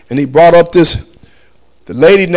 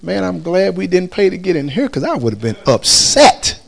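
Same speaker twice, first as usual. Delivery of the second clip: muttering to himself; 7 dB quieter than usual. Retold or imitated. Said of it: "I'm saying to myself".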